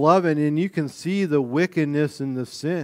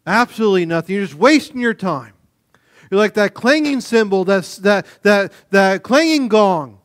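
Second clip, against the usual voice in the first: raised, emphatic preaching voice; 4 semitones higher, 5 dB louder than usual. Acted. Pastor preaching hard to the congregation, emphatic. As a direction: drive it home from the pulpit.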